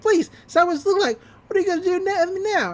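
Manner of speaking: put-on high, fast sneering kid; smug Gideon voice. Gideon taunting the crowd in a high, fast sneer. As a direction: scoff high and quick like a brat. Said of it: "And he's like".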